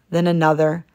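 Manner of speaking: ordinary delivery, nothing marked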